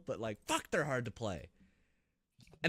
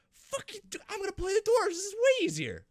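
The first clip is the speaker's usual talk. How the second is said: very high squeaky disbelief, louder; outraged squeak; 4 dB louder than usual. Retold or imitated. Player shocked the enemy built a helicopter, high squeaky outrage. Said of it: "I'm just like".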